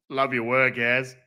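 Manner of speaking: put-on Australian accent, evenly pitched; casual mock-Australian voice; Aussie accent. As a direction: read it out in a light Aussie accent. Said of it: "We've got to do the Aussie accent".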